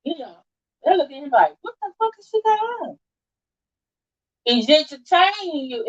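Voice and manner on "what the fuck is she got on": high-pitched scandalised question; higher, incredulous onlooker voice; judgmental mock disbelief. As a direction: mimic a hater looking someone over. Mimics haters judging an outfit.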